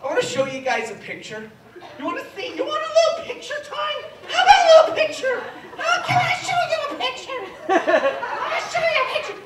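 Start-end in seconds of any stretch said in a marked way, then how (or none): 2.0-9.4 very high-pitched put-on voice; high mock-cutesy pleading; hammed-up squeal. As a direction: squeal the offer in a silly high voice. Hammed-up offer to show picture.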